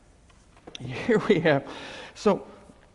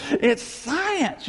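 mocking quote; 8 semitones higher than usual. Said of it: "I hear".

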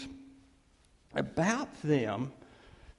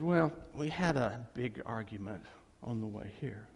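sheepish; 7 semitones lower, 7 dB quieter than usual. Retold or imitated. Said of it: "went over, just kinda sheepishly, kinda chagrined".

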